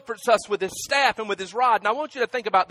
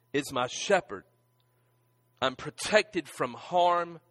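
ordinary delivery

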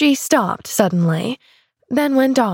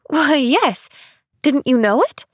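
higher, amazed and eager; eager crying out; 6 semitones higher than usual. Acted. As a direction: exclaim it as obvious. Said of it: "cried Pollyanna".

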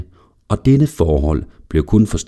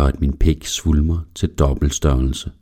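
plain reading voice

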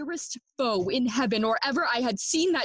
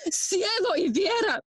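higher-pitched cry of dismay; anguished exclamation; 6 semitones higher than usual. Acted. Hamlet appalled at his mother's marriage, anguished exclamation. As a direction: exclaim it to the heavens in distress. Acted outcry in soliloquy.

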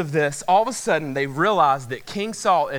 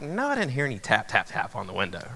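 quick, quieter brush-off, dropping low; retold dismissive reply; 5 semitones lower, 6 dB quieter, faster than usual. Boyfriend answering his girlfriend about tapping, mock dismissive. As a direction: play back an unbothered denial, falling away. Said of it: "And I was like".

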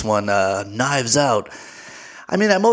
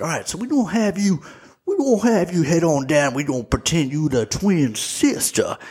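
put-on Southern detective accent; 4 semitones higher than usual. Detective retold asking her to pose as her twin, mock Southern accent. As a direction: imitate a folksy Southern detective. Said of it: "when he's like".

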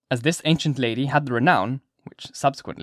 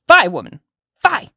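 loud, high scolding outburst; high-pitched, scandalised old-lady shout; old lady's cry; 10 semitones higher, 10 dB louder than usual. A narrator's reading. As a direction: cry it out sharply as an indignant old woman. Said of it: "cried the old lady".

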